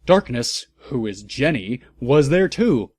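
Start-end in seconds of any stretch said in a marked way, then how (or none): none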